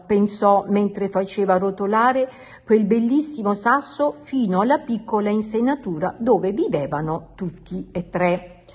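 usual speaking voice

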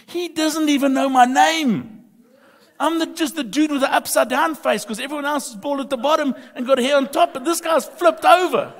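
high, loud comic exasperation; fired-up preaching. Real, not acted. Preacher jokes to congregation about his baby grandson, fired-up and loud.

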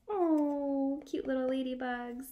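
normal talking voice

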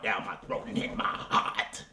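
usual speaking voice

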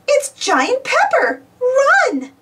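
high, urgent cry of alarm; alarmed shout. A narrator's reading. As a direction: shout a panicked warning, drawing it out. Gardeners flee in alarm.

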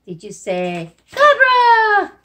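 high, loud, slow question; excited check. Shown as a demonstration. Teacher checking the kids' answer, high, loud and drawn out. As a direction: cheer them on as you check.

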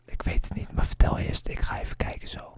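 hushed unvoiced whisper while searching. Real, not acted. Gamer whispering to his friend mid-search. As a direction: whisper it so nobody hears.

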